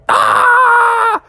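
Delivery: very high scream; high short shriek; startled scream. Acted. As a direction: scream in fright. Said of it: "they were all like".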